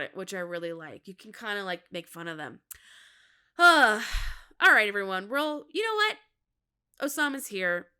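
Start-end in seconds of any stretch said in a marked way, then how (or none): 3.6-4.6 big heaved sigh; loud sigh. Real, not acted. Host winding up before the show, loud heaved sigh.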